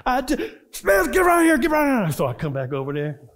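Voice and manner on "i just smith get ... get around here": high, loud fast shout; mock sergeant shout. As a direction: bark the order across the hall.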